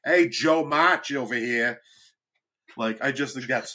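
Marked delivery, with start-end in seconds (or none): 0.0-1.8 put-on loud, drawn-out Boston accent shout. Jokey Little Women remake, in a Boston accent. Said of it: "when the Boston accent started".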